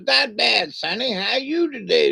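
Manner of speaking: put-on high, creaky old granny greeting. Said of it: "She goes".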